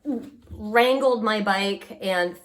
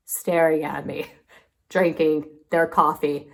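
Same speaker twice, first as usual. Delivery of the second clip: normal talking voice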